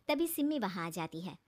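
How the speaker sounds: usual speaking voice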